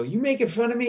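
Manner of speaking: high-pitched affronted drunk, imitated; mock confrontation. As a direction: challenge him as the offended drunk. Drunk slashed man confronts him.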